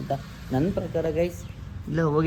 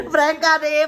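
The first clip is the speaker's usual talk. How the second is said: higher, louder crow of prank ಆದೆ; gleeful reveal; 9 semitones higher, 5 dB louder than usual. Real, not acted. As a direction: announce the prank triumphantly. Revealing the prank.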